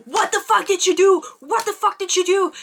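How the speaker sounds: high-pitched indignant confronting; mock outraged yell. Retelling his confrontation at her house, mock angry yell. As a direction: imitate him demanding answers in outrage. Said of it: "He, like, yells at her".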